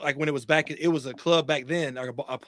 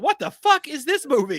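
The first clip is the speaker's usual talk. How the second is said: shocked outburst; 10 semitones higher, 5 dB louder than usual. Retold or imitated. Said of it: "we were like".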